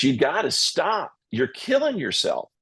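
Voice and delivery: a mock-stern doctor pleading with his patient; retold doctor's warning. Doctor scolding him, retold.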